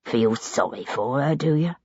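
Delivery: low, mocking villain voice; sneering captor. A narrator's reading. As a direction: taunt her, amused and cold. Sullivan taunts Hero.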